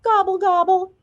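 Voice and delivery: high, loud mocking gobble; turkey imitation. A narrator's reading. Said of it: "Mona imitated a turkey".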